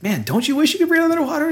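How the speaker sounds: dreamy Ned voice. Retold or imitated. Voicing Owen Wilson's character.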